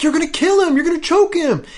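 very high-pitched panicked onlookers imitation; alarmed mock cries. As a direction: cry out as a horrified bystander, hammed up. Gang members cry out in alarm.

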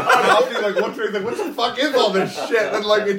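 high, loud laughter through words; laughing. Real, not acted. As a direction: crack up while telling it. Retelling funny scene, laughing hard.

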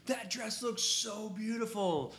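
hammed-up girly gush over the dress; playful gushing. Photographer joking with brides, high gushing voice.

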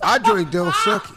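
high, laughing mock suave; hammed-up beer ad boast; mock suave pitchman. Posing as the beer ad man.

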